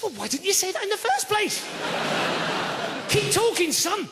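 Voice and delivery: high-pitched sarcastic mock enthusiasm; exaggerated, sarcastic eagerness. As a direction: feign keen interest, voice pitched right up. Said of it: "he didn't get the sarcasm".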